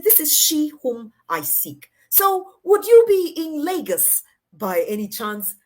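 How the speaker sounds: a lightly mimicked courtly invitation; RDJ's formal manner. The mentor invites her to Lagos, lightly mimicked courtly slowness. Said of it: "that's how he talks".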